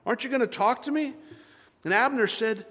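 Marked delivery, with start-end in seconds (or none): 0.1-1.1 high, quick, impatient call; exasperated calling. Acted. David calling to a sleepy Abner, exasperated. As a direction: call out fast and high, fed up waiting. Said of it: "as if he's been calling for hours".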